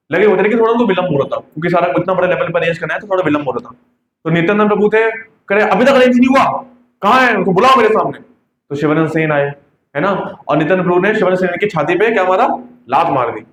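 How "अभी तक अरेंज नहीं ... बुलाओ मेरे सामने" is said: raised, impatient scolding; a scolding guru calling for his servant; angry demand. Retold or imitated. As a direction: bark it out like an annoyed master. Guru angrily demands the arrangement.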